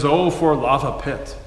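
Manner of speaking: boy imitating great-grandpa's old country accent. Boy asking father about the phrase, old country accent. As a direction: quote the old country accent, lava pit slurred together. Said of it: "in his old country accent".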